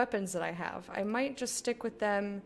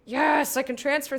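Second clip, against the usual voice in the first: excited yes; 4 semitones higher, 7 dB louder than usual. Real, not acted. Loud exclamation.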